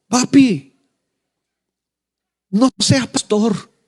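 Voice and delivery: put-on high, slow, pleading child's voice; pleading little girl. Pastor voicing his tearful daughter begging him, high slow plea. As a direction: beg her daddy like a hurt little girl. Daughter comes home almost crying.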